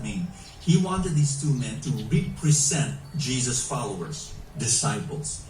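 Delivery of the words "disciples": usual speaking voice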